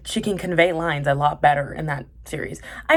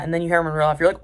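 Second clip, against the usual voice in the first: ordinary talk, nothing marked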